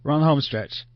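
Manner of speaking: ordinary talk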